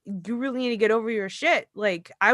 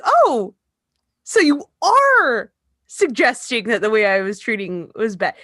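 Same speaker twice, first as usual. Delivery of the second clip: sarcastic retort; 6 dB louder than usual. Retold or imitated. Mocking stepdad's argument back.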